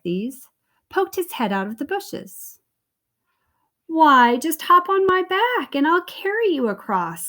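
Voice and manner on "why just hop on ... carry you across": higher, louder sly fox; sly fox luring the cheese man, high and coaxing. Acted. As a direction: sweet-talk him onto your back.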